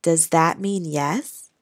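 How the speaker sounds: soft, slow, hushed question; whisper. Acted. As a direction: ask gently, barely breathing it. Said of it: "asked in a whisper".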